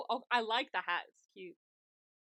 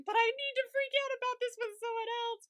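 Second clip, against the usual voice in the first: mock frantic plea; 11 semitones higher than usual. S1 voicing Sun pleading.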